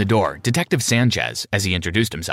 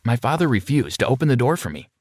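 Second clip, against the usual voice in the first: ordinary delivery, nothing marked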